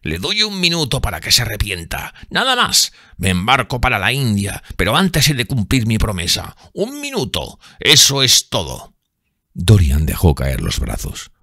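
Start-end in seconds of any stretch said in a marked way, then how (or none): 0.0-8.9 vengeful sailor's threat; menacing sailor. A narrator's reading. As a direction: promise death coldly. James gives a minute.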